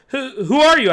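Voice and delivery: high, loud, stammering fright; frightened stammer. A narrator's reading. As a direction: stammer it in fear. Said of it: "I stammered".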